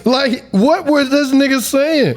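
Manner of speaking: high, slow words through laughter; cracking up at the nonsense; laughing disbelief. Real, not acted. Host laughing at Baltimore clip, high slow disbelief.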